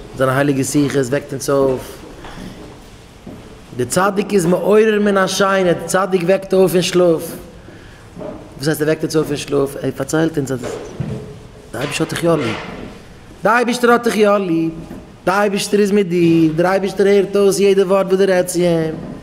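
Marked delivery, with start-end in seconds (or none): 3.8-7.2 high, raised proclamation; impassioned preaching. Real, not acted. Preacher tells listeners the tzadik wakes them, proclaiming it high. 13.4-14.7 high, impassioned preacher's cry; fervent assurance. Acted. Preacher assures the sinner he is loved, crying it high. 15.2-19.1 high, sustained impassioned preaching; fervent assurance. Acted. Preacher assures listeners God hears every word, high and fervent.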